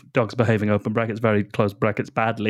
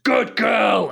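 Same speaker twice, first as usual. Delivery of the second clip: mock shout; 10 semitones higher than usual. Quoting Graham's scream.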